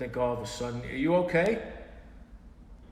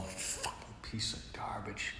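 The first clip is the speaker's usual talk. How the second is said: whispered, frustrated aside; slow, fed-up whispered grumble; under-breath curse; 3 semitones lower, 11 dB quieter, slower than usual. Real, not acted. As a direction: whisper it to yourself, annoyed. Muttering at broken equipment.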